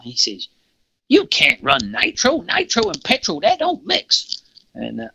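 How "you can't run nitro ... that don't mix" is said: Yosemite Sam. Retold or imitated. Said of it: "He looks like Yosemite Sam".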